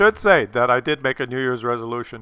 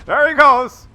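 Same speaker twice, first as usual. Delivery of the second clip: loud, high burst of glee; gleeful yelling at the screen; excited shout; 9 semitones higher, 11 dB louder than usual. Real, not acted. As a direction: call it out like a charging cavalry. Excited call as tank charges.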